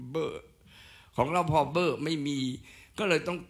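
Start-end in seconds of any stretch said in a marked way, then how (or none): 0.1-0.4 "เบอร์" as a short voiced b syllable; voiced B sound. Shown as a demonstration. Lecturer explaining the letter's original value, short "เบอร์". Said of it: "ไอ้พอพานเนี่ยมันเป็น".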